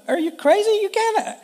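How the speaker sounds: put-on high-pitched, indignant British accent. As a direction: do your best British accent, pitched right up. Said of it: "that's my best British accent".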